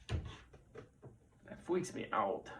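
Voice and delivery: breathy, slow whispered fright; scared whisper. Retold or imitated. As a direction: whisper it like a spooked kid. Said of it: "That's what my son says when he gets scared".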